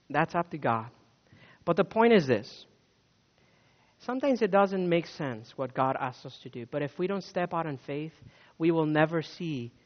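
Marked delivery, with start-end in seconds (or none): none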